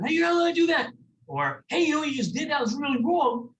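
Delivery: high, loud scolding yell. Shown as a demonstration. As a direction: snap at them like a scolding adult. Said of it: "instead of yelling at them".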